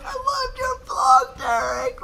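high, drawn-out mock fan squeal; hammed-up high-pitched adoration; gushing fan impression. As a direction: imitate an over-excited fan calling out. Acting out fans coming up.

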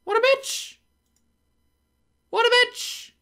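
high-pitched indignant cry; squealed outrage. Real, not acted. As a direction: shriek it in disbelief. Annoyed at enemy after fleeing.

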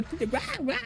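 playful bark like a little dog; mock dog barking. Streamer imitating the chat alert as a barking dog. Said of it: "van a poner la alerta".

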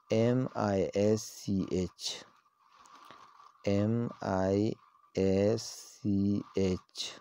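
letters M I S C H spaced out very slowly; slow spelling. Shown as a demonstration. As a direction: dictate each letter with long gaps. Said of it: "letters spelled out".

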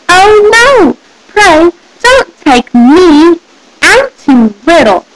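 small goat pleading, starting high; high, drawn-out little plea. A narrator's reading. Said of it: "in a small, small voice".

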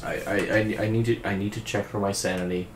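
normal talking voice

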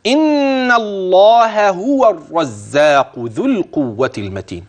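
slow chanted tajweed recitation, high and drawn out; teacher modelling slow melodic recitation; Quran recitation. Shown as a demonstration. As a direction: intone the verse slowly like a reciter. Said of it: "تبدأ".

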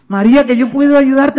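small helper losing patience, high; insistent little voice. Acted. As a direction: pipe up loudly with the offer. The little voice insists once more.

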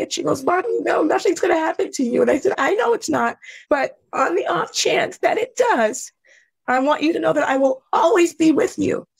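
high, strained voice close to tears; tearful. Real, not acted. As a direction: choke up telling a daughter you love her. Tearful retelling of farewell to daughter.

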